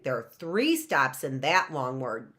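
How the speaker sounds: ordinary talk, nothing marked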